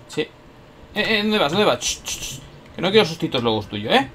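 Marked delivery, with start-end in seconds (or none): 1.8-2.4 unvoiced soft shush; quiet ch ch shushing. Real, not acted. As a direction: hush the enemy softly. Shushing while sneaking.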